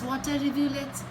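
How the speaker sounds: ordinary delivery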